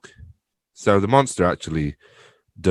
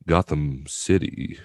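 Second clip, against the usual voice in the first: low, drawn-out Batman voice; slow dark superhero, kept low; Kevin Conroy; 4 semitones lower, 4 dB quieter, much slower than usual. Retold or imitated. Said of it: "like, Kevin Conroy, like".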